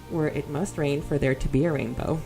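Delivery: ordinary talk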